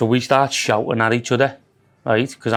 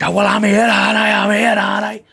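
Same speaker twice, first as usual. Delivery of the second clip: imitating the man; 7 semitones higher than usual. Voicing the man's reply.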